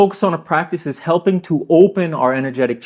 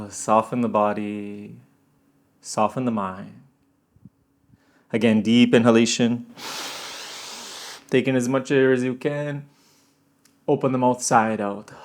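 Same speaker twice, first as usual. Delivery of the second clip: guided meditation; 4 semitones lower, 5 dB quieter than usual. Acted. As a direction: guide them gently into calm. Guides breathing practice.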